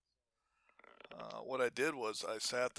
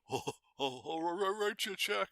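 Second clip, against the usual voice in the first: high, louder, nervous stammering excuse; flustered backpedal; 8 semitones higher, 3 dB louder than usual. Retold or imitated. Debtor at the door scrambling, flustered and higher. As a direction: voice the cornered debtor scrambling to please.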